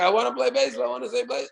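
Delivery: put-on child pleading. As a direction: nag like a kid who won't let up.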